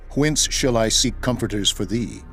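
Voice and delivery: usual speaking voice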